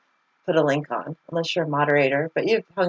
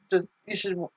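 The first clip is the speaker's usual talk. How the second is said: ordinary talk, nothing marked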